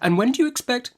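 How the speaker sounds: high, brisk stern aunt; Miss Betsy. Acted. Aunt Betsy interrogating the young mother, brisk and high. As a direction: snap the question like a stern old aunt.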